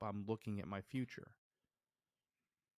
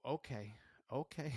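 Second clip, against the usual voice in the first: mildly nervous okay, second one higher; retold nervous agreement; 4 semitones higher than usual. Frightened climber agrees to go up, mildly nervous. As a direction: agree reluctantly, with a small laugh after.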